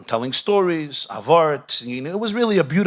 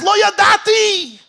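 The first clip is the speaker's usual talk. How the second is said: loud proclamation; 8 dB louder, slower than usual. Acted. Preacher cries out the key phrase.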